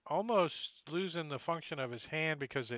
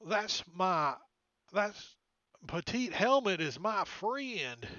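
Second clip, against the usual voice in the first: lightly raised, slow creature voice; NPC voice; 5 semitones higher, slower than usual. Acted. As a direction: plead a bit for the friend, slowly. DM voices NPC defending friend.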